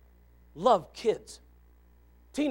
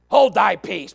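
louder stern command; rebuke repeated; 11 dB louder, slower than usual. Acted. Preacher repeating Jesus's rebuke, firm and loud. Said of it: "nice way to say Shut up".

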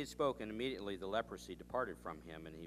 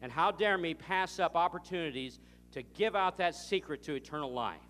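loud, slow, much higher "How dare me"; indignant preaching; 8 semitones higher, 9 dB louder than usual. Acted. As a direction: thunder it from the pulpit. Preacher turns the accusation on himself.

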